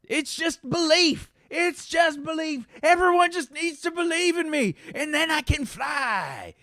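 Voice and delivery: very high, drawn-out desperate cry; high-pitched, stretched-out yelling in character; Brandt yelling. Acted. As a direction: wail it out slowly as a deluded believer.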